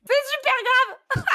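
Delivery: high, slow laughing emphasis. Real, not acted. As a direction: laugh through it, drawn out. Laughing mock seriousness.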